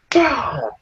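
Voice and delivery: sharp high martial-arts shout; fight yell. Retold or imitated. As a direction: let out a quick taekwondo kiai. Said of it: "So I just went fucking".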